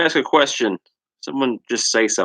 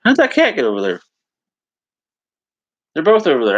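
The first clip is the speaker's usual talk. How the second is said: higher, louder, puzzled surprise; surprised; 6 semitones higher, 6 dB louder, faster than usual. Real, not acted. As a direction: caught off guard by the cats. Cats suddenly seen elsewhere.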